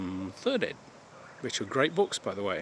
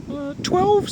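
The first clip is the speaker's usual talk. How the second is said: put-on much higher, innocent kid voice; naive young gamer; 5 dB louder than usual. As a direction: answer like a clueless young player, high and eager. Imitating young Derek answering.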